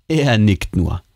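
plain reading voice

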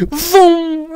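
high, drawn-out whoosh sound; playful vocal sound effect; vroom noise. Pretend. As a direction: imitate something zooming off. Imitates a noise.

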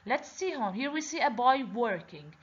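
ordinary delivery

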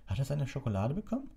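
high-pitched, concerned question. A narrator's reading. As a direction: ask the nurse with mild worry. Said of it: "fragte er besorgt Madame Pomfrey". (besorgte Nachfrage, hohe Stimme)

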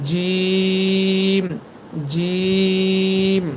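জিম with a very long "ee" closing on m; mid-tongue to palate, sustained জিম; full letter sound. Shown as a demonstration. Teacher demonstrating the letter jim, long held জিম. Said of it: "দুই দাঁত সামান্য ফাঁকা রাখবেন".

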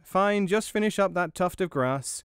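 high mouse voice giving orders; calling out. A narrator's reading. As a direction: call out instructions in a high voice. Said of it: "while still calling out".

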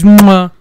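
big loud kiss sound; exaggerated kissing noise; loud smooch. Real, not acted. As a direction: smack a noisy kiss at the dog. Kissing his dog.

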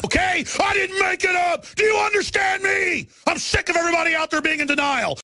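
high shouted demand; furious yelling. Real, not acted. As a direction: scream it at the audience. Furious at listeners.

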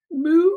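high playful moo; cow moo. Acted. Reading to preschoolers, a high playful cow moo.

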